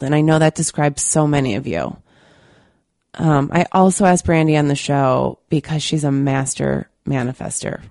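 ordinary talk

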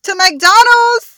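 loud, high, drawn-out cheer; excited shout. Pretend. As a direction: holler it out slowly, way up high. Bursts in to finish the sentence.

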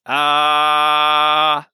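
loud, high, steady sung Ah; guttural chant held without wavering; held guttural note. Acted. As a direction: hold one unwavering note. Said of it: "He was holding the same note".

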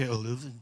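Louvre softly in a mock French way; French-style Louvre. Shown as a demonstration. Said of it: "acento internacional ... en Frenchy". (Louvre con acento francés en broma)

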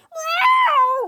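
very high, crying cat scream; a falling cat shrieks meow. Acted. Cat knocked out of the tree, screaming meow. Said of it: "screamed the cat".

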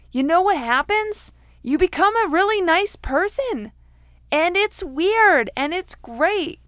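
bright, high, delighted reveal; excited. Real, not acted. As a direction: gush it like a happy secret. Gushing about becoming nice.

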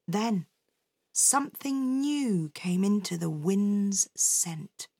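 ordinary delivery

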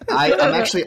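laughing through the words; laughing burst. Real, not acted. Friend cracks up at his own joke, laughing.

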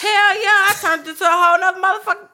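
loud, high and fast; excited. Real, not acted. Excited boast, new persona.

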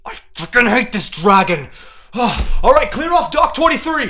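high, loud exasperated shout; annoyed yell. Acted. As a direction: bark the order in irritation. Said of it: "just says".

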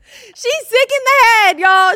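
high, loud, slow, laughing disbelief; incredulous laughing exclaim. Retold or imitated. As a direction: cry out in amused shock. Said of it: "I'm like".

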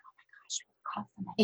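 hushed whisper of gossiping onlookers; voiceless mock whisper; imitated whispering. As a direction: whisper it like a shocked bystander. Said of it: "you can almost hear them whispering".

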